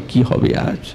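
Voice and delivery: whispered worried thought; anxious whisper. Acted. The sakhis wondering inwardly what will happen, whispered. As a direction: whisper it to yourself, fearful. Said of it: "মনে মনে ভাবছে".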